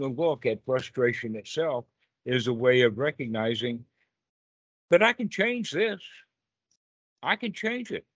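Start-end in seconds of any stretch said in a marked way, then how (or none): 4.9-8.0 emphatic. Real, not acted. Teacher urging students to change.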